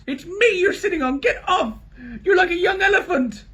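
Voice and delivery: very high, loud indignant yelp; dwarf yells at Edmund; angry squashed dwarf. A narrator's reading. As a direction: yell from under the weight. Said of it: "came an angry voice".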